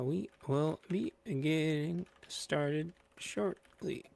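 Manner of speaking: stretched, slow and drawn out; slow announcement. Pretend. Host stalling the waiting viewers, slow and drawn out. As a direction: drag the words out like a stalling host.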